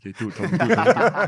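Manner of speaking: mock chant that builds to a loud high call; egging on. Retelling teammate egging him on.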